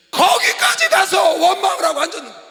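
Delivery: high, very loud pulpit outburst; indignant shout. Real, not acted. Pastor decrying exiles who still complain, indignant shout. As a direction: shout it in exasperation to the crowd.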